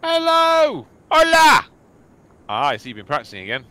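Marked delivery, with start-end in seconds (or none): 0.0-1.7 loud high sing-song greeting; loud silly hello. Pretend. Mock greeting exchange.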